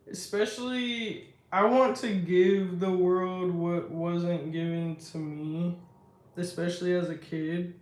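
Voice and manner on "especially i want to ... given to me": usual speaking voice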